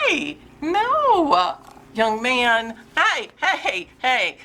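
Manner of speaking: high, drawn-out telling-off; scolding a pet. Real, not acted. Owner to misbehaving young cat, high scolding.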